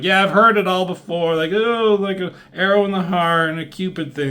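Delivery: high, mocking sing-song, fed up with the love talk. Iceman teasing Gambit about Rogue, mocking high voice. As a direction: roll your eyes and mock him up high.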